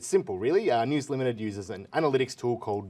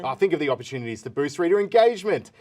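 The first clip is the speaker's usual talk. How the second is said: excited; 4 semitones higher, 6 dB louder than usual. Acted. Pitching clickbait with glee.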